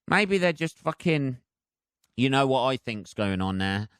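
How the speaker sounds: ordinary delivery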